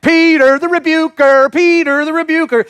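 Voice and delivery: high, loud mocking chant; teasing ribbing. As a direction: rub it in like a schoolyard taunt. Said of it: "He was like".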